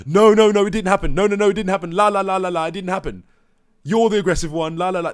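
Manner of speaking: loud, high sing-song mockery; hammed-up la la la denial; mocking childish denial. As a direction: chant it like a child with fingers in ears. Mocking staff denial, fingers in ears.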